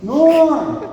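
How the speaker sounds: loud high bar greeting cry; hearty group yell across a room; crowd shout of Norm. Retold or imitated. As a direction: bellow the name like a whole bar greeting him. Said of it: "What does everybody do when Norm came in that room".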